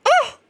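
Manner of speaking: short yelp jumping very high; a quick startled cry; sharp shocked yelp. Real, not acted. Seeing the twist on screen, a startled high yelp.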